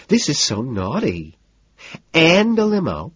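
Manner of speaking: slow, high squeal of delight; pleased squeal. A narrator's reading. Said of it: "she squeals, pleased".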